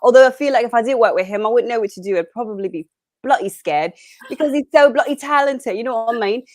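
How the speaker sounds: put-on chatty, enthusiastic British accent. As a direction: gush like a Brit with a put-on accent. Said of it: "falling into these accents".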